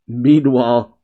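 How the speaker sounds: usual speaking voice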